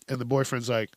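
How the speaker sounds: normal talking voice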